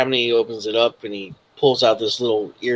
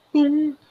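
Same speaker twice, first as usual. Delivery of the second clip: high, held electronic "boom" tone; device start-up chime. Acted. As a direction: imitate an earpiece powering on with a high hum.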